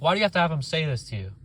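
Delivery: normal talking voice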